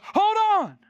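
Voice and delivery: put-on high, loud old man's call; sudden excited cry; Simeon calls out. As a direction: call out like an eager old man. Simeon calling out in retelling.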